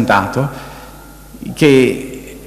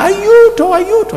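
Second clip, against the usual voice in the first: very high, desperate cries for help; retold screams for help; 3 dB louder than usual. Dying woman screams for help, very high desperate cry. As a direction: shriek for help in agony. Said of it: "E lei gridava".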